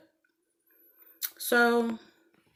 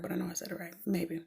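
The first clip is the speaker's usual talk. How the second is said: hushed, half-whispered self-doubt; muttered aside; 4 semitones lower, 15 dB quieter, much faster than usual. Real, not acted. Checking her word with herself, muttered aside. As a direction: mumble it softly to yourself, trailing away.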